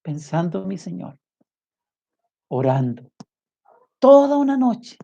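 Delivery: high, pleading preacher's lament; impassioned preaching. Acted. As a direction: cry out the shame to the congregation. Fervent shame over Jesus praying.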